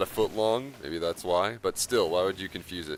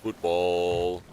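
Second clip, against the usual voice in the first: mock British accent. Says the word in put-on accent.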